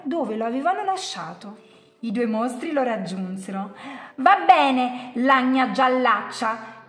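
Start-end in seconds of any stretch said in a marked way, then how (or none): 4.1-6.9 grudging insult. A narrator's reading. First monster grudgingly agrees with yellow monster, jeering. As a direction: give in loudly with a jeer. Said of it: "gli disse il primo".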